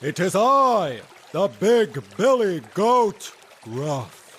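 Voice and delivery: booming goat. Acted. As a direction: announce yourself boldly. Big goat booms.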